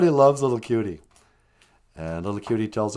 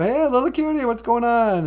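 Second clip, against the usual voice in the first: very high, louder sing-song hello; excited bird greeting; 13 semitones higher, 6 dB louder than usual. Acted. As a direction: swoop in calling a cheery hello. Birds greet Little Cutie.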